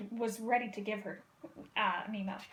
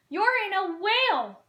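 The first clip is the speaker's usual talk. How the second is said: loud, higher, slow panicked shout; 7 semitones higher, 6 dB louder than usual. Acted. Marlin shouting to Dory inside the whale, panicked. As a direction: yell it in alarm. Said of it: "shouted Marlin".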